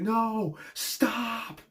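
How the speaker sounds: drawn-out, pleading whine; tearful pleading. Retold or imitated. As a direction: imitate someone begging through tears. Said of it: "he's, like, crying".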